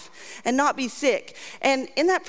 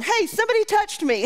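much higher, calling out over a crowd; mock call-out; 8 semitones higher than usual. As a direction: yelp it like you're lost in a stadium crowd. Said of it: "and going".

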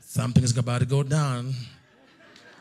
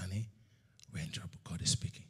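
breathy, hushed and scared; frightened whisper; 8 semitones lower, 17 dB quieter, faster than usual. Retold or imitated. Husband whispering in fear to his wife in the car. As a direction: whisper like God can hear you.

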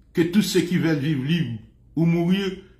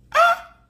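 sharp high cry; 3 dB louder than usual. Real, not acted. Loud exclamation, no words.

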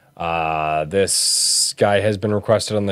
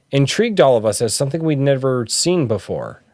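ordinary talk, nothing marked

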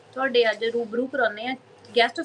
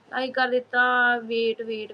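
ordinary delivery

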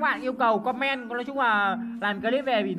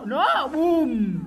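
much higher, loud, slow announcing shout; hyped showoff; 8 semitones higher, 4 dB louder, much slower than usual. Real, not acted. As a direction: call out the big combo like a showman. Showing off a big combo. (hét khoe chiêu, cao và to)

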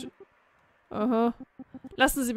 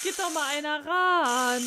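calling out; 4 semitones higher than usual. Acted. Calling someone to answer phone.

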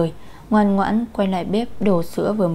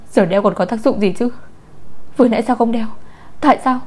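raised accusing cry, building higher; narrated distraught yell; hoarse yelling; 3 semitones higher, 3 dB louder than usual. A narrator's reading. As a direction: demand answers, voice climbing. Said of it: "khàn giọng hét".

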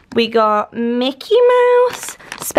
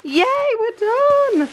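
excited; 13 semitones higher, slower than usual. Real, not acted. Finishes unpacking the gifts.

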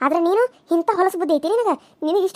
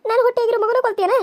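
ordinary delivery

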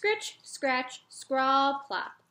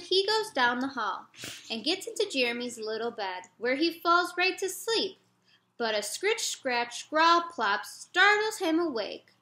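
ordinary delivery, nothing marked

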